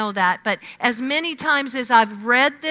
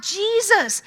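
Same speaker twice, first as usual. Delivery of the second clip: crying out; 8 semitones higher than usual. Retold or imitated. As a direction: call out across a crowd. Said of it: "crying out".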